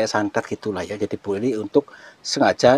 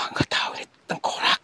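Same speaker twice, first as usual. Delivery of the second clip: scared whisper. Real, not acted. Finds a skull.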